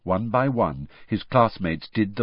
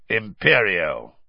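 slow, deliberate spell cast; commanding incantation; 4 dB louder, slower than usual. A narrator's reading. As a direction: cast the curse with authority. Casting the curse.

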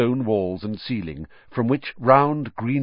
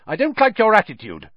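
much higher, louder indignant prefect; outraged; 11 semitones higher, 5 dB louder than usual. A narrator's reading. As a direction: bristle with offended pride. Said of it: "Percy looked outraged".

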